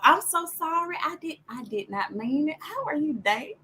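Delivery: high, sugary-innocent Southern apology; naive Southern accent. Acted. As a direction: play innocent with a put-on Southern twang. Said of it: "when they hear your southern accent, that you naive".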